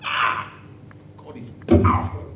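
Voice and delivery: ordinary talk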